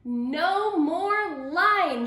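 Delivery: loud, very slow, high cheer; drawn-out victory shout; joyful shout. A narrator's reading. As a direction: townspeople celebrating at full voice. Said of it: "shouted the townspeople".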